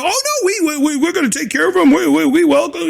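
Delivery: hurried, stammering mock reassurance; mocking imitation. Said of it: "you notice as they talk".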